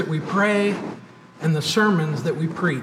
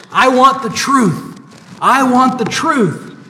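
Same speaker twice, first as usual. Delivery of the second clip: higher, louder movie-quote shout; retold shout; 5 semitones higher, 4 dB louder than usual. Preacher retells Cruise grilling Jack Nicholson, mock shout. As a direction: belt it like a heated courtroom demand. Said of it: "he screams".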